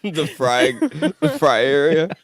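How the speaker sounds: loud, high laughing delivery. Pretend. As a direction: crack up as you say it, voice climbing. Mocking fancy name amid laughter.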